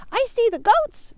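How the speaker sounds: high squeaky little rabbit asking. Acted. Rabbit spots goats.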